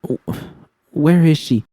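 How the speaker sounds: high, broken, stammering fear; croaked panic. Acted. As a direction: choke out the question in terror. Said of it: "I croaked".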